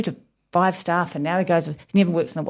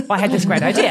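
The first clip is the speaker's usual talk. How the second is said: confident claim; 3 semitones higher, 3 dB louder than usual. Shown as a demonstration. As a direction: say it proudly, with a laugh. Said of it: "I would say".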